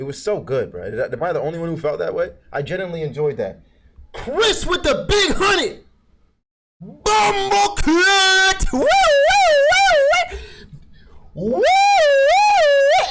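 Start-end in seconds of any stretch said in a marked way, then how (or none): 4.1-8.7 high, slow hype yell; excited yelling. Real, not acted. Celebrating a donation on stream. 8.7-13.0 high drawn-out whooping; cheering woos. Real, not acted. Cheering wildly.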